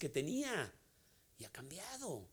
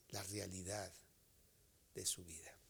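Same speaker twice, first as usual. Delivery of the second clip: much softer, fading into a whisper; 8 semitones lower, 12 dB quieter than usual. Acted. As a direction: let the words die into a whisper. Sermon drops to a whisper.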